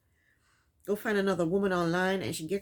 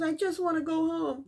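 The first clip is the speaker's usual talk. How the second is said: whiny mock-sob, pitched higher; mock fake crying; 8 semitones higher than usual. Recapping the show, mocking Jasmine's fake tears: whiny mock-sob. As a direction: mimic her phony crying to mock it. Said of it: "Jasmine is in some other room fake crying".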